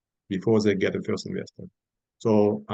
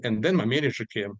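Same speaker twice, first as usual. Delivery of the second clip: ordinary talk, nothing marked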